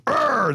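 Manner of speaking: a sudden high mock roar of frustration; mock angry yell. Imitating his yelling mentor urging him on, mock angry roar. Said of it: "He's like, screams and yells".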